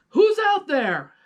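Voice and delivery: high, loud shout into the night. Acted. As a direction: yell from the window at an intruder. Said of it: "Pete shouted".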